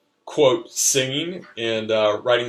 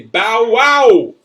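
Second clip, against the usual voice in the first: barking call; 11 semitones higher, 12 dB louder than usual. Acted. Spy Boy barks his call.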